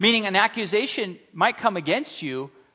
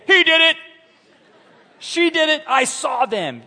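put-on shrill shouted kids' voices, much higher and louder; tattling kids; 12 semitones higher, 9 dB louder than usual. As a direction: yell it like tattling toddlers.

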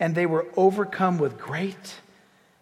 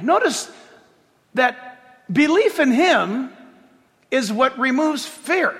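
ordinary talk, nothing marked

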